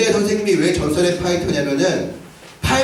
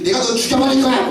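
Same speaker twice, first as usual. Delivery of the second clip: higher, hammed-up threatening yell; mock villainess threat; 7 semitones higher than usual. Teacher mocking a woman's threat to shame the boys. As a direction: shout the threat like a cartoon villainess. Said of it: "여자가 막 이렇게".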